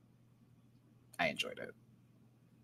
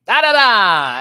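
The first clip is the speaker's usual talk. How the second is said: loud, high mock yell of the word; cartoon dad yelling the answer; imitated yell; 9 semitones higher, 13 dB louder, slower than usual. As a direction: blurt it out full volume like a confident guesser. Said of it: "he just yells it".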